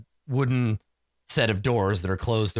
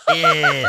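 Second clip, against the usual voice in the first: short loud eh; playful devil grunt; louder little devil eh; mock devil noise; 4 semitones higher, 7 dB louder than usual. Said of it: "gives him a little horns".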